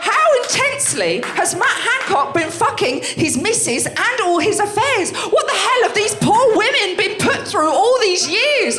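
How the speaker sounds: high, loud, slow comic outrage; outraged rant. Real, not acted. Comic to crowd about Hancock, loud slow outrage. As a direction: build the disbelief into a shout.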